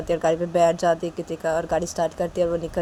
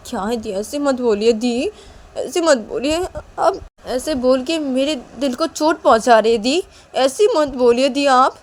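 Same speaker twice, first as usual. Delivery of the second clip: rising, louder plea not to be so cruel; hurt pleading; 7 semitones higher, 8 dB louder, slower than usual. Acted. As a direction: beg her to stop, wounded.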